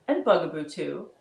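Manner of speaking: ordinary delivery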